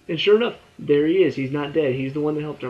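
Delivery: ordinary talk, nothing marked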